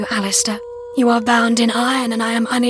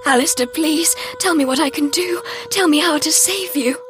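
very high, urgent plea; frantic, tearful-sounding heroine; desperate pleading; 10 semitones higher than usual. A narrator's reading. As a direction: beg to save a dying lover. Heroine begs dying lover.

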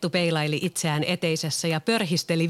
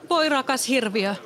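a much higher anguished cry; Beauty exclaiming; 8 semitones higher than usual. A narrator's reading. Beauty cries out over the bound monster, much higher. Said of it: "Kaunotar huudahti".

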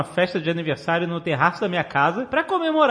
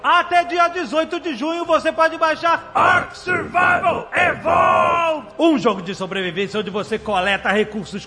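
ad read; 4 semitones higher, slower than usual. Acted. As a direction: sell it like a radio spot. Reads a sponsor ad.

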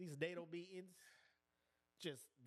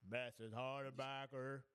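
slow mock address; 3 semitones lower, much slower than usual. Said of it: "imitating council member".

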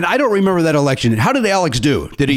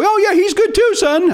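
much higher, bright fake praise; high-pitched fib; slower than usual. A dad tells his son a guest is good, lying in a high voice. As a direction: squeak it out like a polite lie. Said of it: "lies always have the high-pitched voice".

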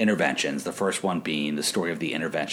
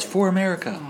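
high mock cheer; yelling; 12 semitones higher than usual. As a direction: call it out like a fan. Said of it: "Or yells".

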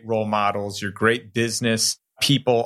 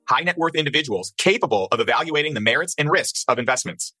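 rapid, slightly higher legal read; fast legal disclaimer; 4 semitones higher, faster than usual. Acted. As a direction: rattle through the fine print.

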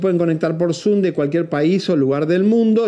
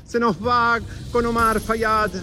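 calling out a send-off; 5 semitones higher than usual. Real, not acted. Reporter to fans at Victoria's departure, calling out a farewell. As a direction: whip up the crowd for a star's send-off.

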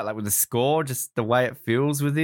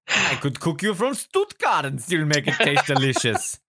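hammed-up German villain as food writer; 3 semitones higher than usual. Joking about the actor's food writing, mock German accent. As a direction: parody the henchman in thick German. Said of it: "the most stereotypical German accent".

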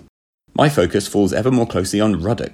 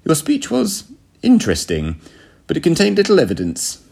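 high, thin-willed captain's voice; feeble little man; 9 semitones higher than usual. A narrator's reading. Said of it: "the feeble little man simply said".

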